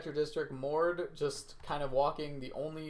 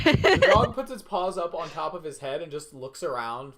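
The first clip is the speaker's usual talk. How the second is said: laughing; 8 dB louder than usual. Real, not acted. GM laughing while describing dog.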